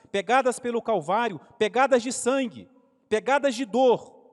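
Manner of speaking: loud, rising sermon climax; impassioned sermon voice, building; preaching at full force. Acted. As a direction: build it up over the pegadas. Preaching climax on Christ's path.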